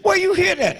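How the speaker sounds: high, sharp scolding mother; angry mother. Retold or imitated. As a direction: scold like a shocked mom. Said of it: "She said".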